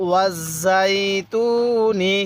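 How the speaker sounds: ওয়াজাইতুনি joined and drawn out very slowly; slow recitation. Shown as a demonstration. Teacher revising ওয়াজাইতুনি with students, very slow. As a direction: stretch ওয়াজাইতুনি syllable by syllable. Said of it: "কিভাবে শিখব?".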